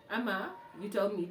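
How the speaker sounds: usual speaking voice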